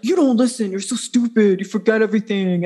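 mocking a jerk ex with a put-on accent; a dopey, sneering boyfriend voice, lightly voiced; stupid boy voice. Imitating her jerk ex-boyfriend scolding her, in a mocking stupid boy voice. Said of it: "That was my, like, stupid boy voice".

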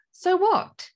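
high, punchy rhetorical comeback; dismissive challenge. Acted. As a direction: throw the question back at the doubter. Acted challenge to the fearful objection.

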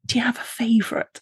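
soft, secretive whisper; conspiratorial whisper; whispered question. Retold or imitated. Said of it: "I was like".

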